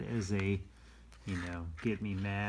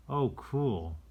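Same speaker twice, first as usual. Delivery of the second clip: ordinary delivery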